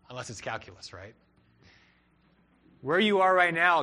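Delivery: ordinary talk, nothing marked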